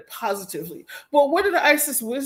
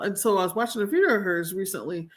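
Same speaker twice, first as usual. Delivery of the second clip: ordinary talk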